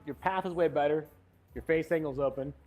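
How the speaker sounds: ordinary delivery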